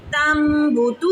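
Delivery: तम बूतू with the M hummed for a long beat; one-alif ghunna. Shown as a demonstration. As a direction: slowly hold the nasal M of तम before बूतू. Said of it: "जितना मैं आपको समझा रही हूँ".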